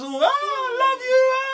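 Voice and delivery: high, slow mock-sobbing lover; hammed-up teary love confession; mock crying love plea. Mocking the actress's crying "I love you" in a hammed-up sob.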